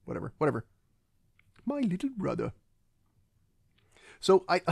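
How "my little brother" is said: high-pitched mock villain line; Christoph Waltz. Villain naming his adoptive brother, high theatrical impression. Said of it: "my problem with Christoph Waltz is".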